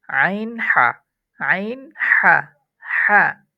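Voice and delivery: হা from the middle of the throat, loud and breathy; আইন হা, হা pushed from mid-throat. Shown as a demonstration. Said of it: "হলকের মধ্যখান থেকে". (হা হলকের মধ্যখান থেকে উচ্চারণ)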